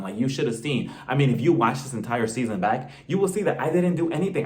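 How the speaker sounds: ordinary talk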